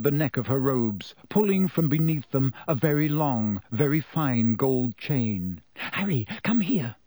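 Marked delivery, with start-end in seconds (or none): 5.8-7.0 urgently. A narrator's reading. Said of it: "she said urgently".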